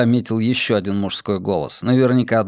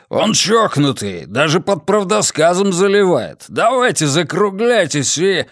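indignant, a bit louder and higher; 6 semitones higher, 3 dB louder than usual. Acted. Hermund protesting to the others, indignant. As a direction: scoff at the madman. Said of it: "возмущённый голос Гермонда".

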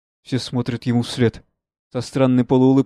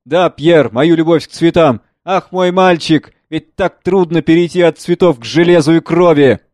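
ironic; 5 semitones higher, 9 dB louder than usual. Acted. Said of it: "Иронически".

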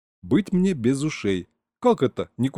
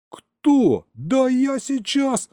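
high, loud flare-up; jealous outburst; 14 semitones higher, 4 dB louder, much slower than usual. Acted. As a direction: explode at the unseen rival. Jealous outburst at news about Anyuta.

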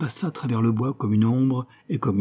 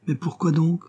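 a little daisy's voice, pitched higher; small sweet voice; 6 semitones higher than usual. Acted. Daisy asking her neighbour, in a small sweet voice. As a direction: murmur it sweetly like a tiny flower. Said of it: "murmurait une pâquerette ... douce petite voix".

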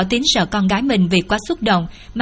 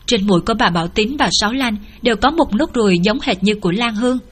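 ordinary delivery, nothing marked

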